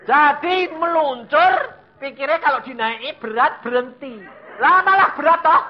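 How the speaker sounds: high, loud, animated preaching; full-force storytelling. Acted. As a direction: shout out the runaway mishap. (berkhotbah dengan seru dan lantang)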